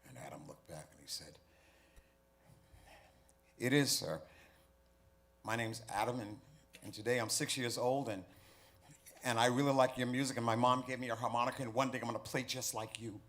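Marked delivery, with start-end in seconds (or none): 3.6-13.2 put-on higher, much louder child blurting it out; eager little boy. Six-year-old Adam telling his hero about himself, high and eager. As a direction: gush it all in one excited breath.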